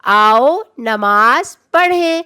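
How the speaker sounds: آؤ نماز پڑھیں spoken slowly and evenly; slow model sentence. Shown as a demonstration. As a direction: read it out slowly for the children. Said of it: "سب مل کر میرے ساتھ اس جملے کو پڑھیں". (آؤ نماز پڑھیں کو آہستہ آہستہ پڑھ کر دکھانا)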